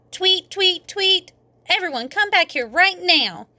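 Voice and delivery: high, whistling call then an angry order; shouted real mad. A narrator's reading. Daddy calling the children back, angry high shout. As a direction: blow the whistle and yell at the kids. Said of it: "he shouted real mad".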